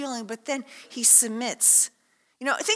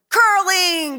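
loud, higher, punched one-word reveal; triumphant answer; 6 semitones higher, 8 dB louder than usual. Real, not acted. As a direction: announce the answer with gusto. Answering his own quiz.

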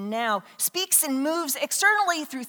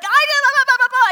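loud, high-pitched gush of babble; excited kids; 13 semitones higher, 11 dB louder, faster than usual. Retold or imitated. Preacher imitating kids rushing in all excited, loud excited babble. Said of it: "they're all excited".

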